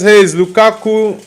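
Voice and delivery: ordinary talk, nothing marked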